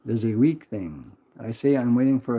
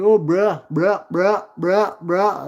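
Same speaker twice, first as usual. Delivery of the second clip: louder, higher mock bruh, bruh; mocking young generation; 5 semitones higher, 5 dB louder, much slower than usual. Streamer mocks the young generation, loud and higher. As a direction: parody kids spamming bruh. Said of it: "the whole young generation be like".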